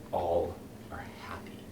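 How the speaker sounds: soft, unvoiced whisper; a menacing whispered "all are happy"; sinister whisper. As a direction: let it drip out quietly. Sinister aside.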